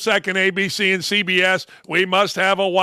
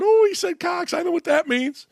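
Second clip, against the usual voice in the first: put-on kids' voice, higher, sniggering; mock giggling kids; 8 semitones higher than usual. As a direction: imitate kids who just got the dirty joke. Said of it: "They're going".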